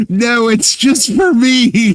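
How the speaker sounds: high, slow mocking voice of him; a hint of a whiny guy; mocking quote. Mocking what he said.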